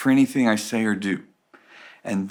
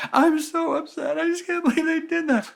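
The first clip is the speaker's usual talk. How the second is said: whiny complainer. Retold or imitated. Complainer whining about someone to the pastor. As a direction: drag it out like a sulky child. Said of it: "if you come to me and go".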